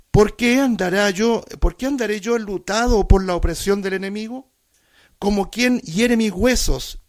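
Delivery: loud, rising psalm recitation; preacher pleading the psalmist's cry before the church; impassioned scripture reading. Acted.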